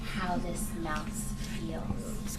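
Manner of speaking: slow whispered wondering. Shown as a demonstration. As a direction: breathe it secretly to the kids. Thinking aloud, hushed.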